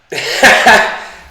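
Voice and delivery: sudden high, loud chuckle; short laugh. Real, not acted. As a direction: burst out in a brief, breathy laugh. Joking about Lambo Calrissian.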